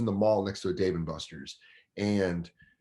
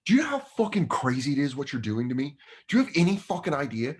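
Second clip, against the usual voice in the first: retold outburst, pitched up; snapping; 6 semitones higher, 4 dB louder than usual. Comic confronts heckler onstage, snapping. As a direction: lose patience, voice climbing. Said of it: "and I snap".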